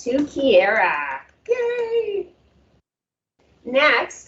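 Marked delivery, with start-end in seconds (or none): 1.5-2.3 high, drawn-out yay; cheering. Real, not acted. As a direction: cheer sweetly in a high, held note. Host cheers the award winner.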